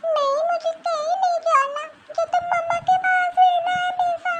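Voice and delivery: put-on a small child refusing to leave her mother; little girl pleading to stay with mama; upset little girl. Girl protests leaving home.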